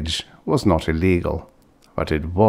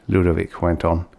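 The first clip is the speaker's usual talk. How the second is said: ordinary delivery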